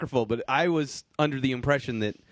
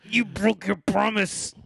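higher, slow mock wrestler wail; hammed-up Macho Man; 6 semitones higher, slower than usual. Hosts trading Macho Man lines, slow high mock wail. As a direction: overplay the hurt slowly.

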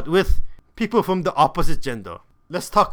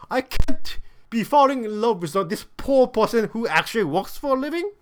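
higher-pitched mock protest, rising at the end; hero in self-denial; 4 semitones higher than usual. Rich lead denies loving a poor girl, mock dismayed. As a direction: act out a rich man's disbelief, pitched up.

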